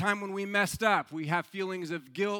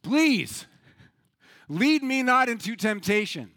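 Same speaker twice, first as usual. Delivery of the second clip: louder, pleading prayer with long pauses; acted desperate plea to God; 3 semitones higher, 7 dB louder than usual. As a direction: beg it, leaning hard on PLEASE. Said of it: "kneels down again in front of the picture".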